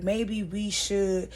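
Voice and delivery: very slow, tentative start to a sentence; hesitant suggestion. Retold or imitated. [name] starting to propose something, tentative and slow. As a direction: draw it out, leaving it hanging. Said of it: "she cut him off".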